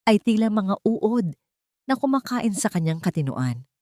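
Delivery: ordinary delivery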